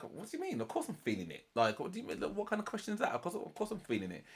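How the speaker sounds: incredulous, pitch-jumping retort; defensive protest. Retold or imitated. Him answering whether he's feeling it, defensively incredulous. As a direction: retell his affronted comeback.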